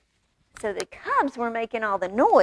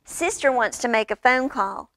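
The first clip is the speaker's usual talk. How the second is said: ordinary delivery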